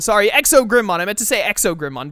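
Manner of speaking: ordinary talk, nothing marked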